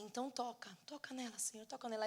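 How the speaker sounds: understated retelling of weepy praying; tearful plea. Retold or imitated. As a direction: beg quietly, as if crying. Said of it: "mas assim, chorando".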